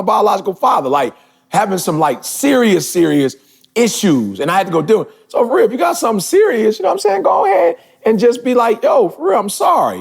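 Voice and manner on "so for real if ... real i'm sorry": urgent. Real, not acted. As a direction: push them to go make peace now. Urging listeners to apologize.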